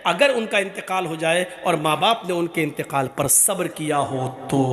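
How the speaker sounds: ordinary talk, nothing marked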